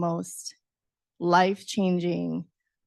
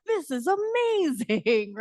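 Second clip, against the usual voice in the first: high, slow amazed gasp; 8 semitones higher, slower than usual. Retold or imitated. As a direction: gush over it in wonder. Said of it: "I was just like".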